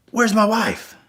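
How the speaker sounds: high-pitched imitation of a worried husband; demanding husband. Husband questioning stranger at hospital, high demanding imitation.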